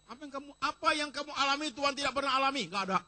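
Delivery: loud, fast sermon push; preacher firing a question at the congregation; fiery preaching. Acted. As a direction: hammer the question at the crowd. Pastor presses the congregation.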